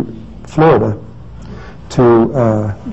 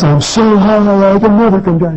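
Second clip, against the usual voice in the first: excited Slim; 9 semitones higher than usual. Retold or imitated. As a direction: stretch it out, pitched up and thrilled. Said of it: "he's so excited he couldn't even talk".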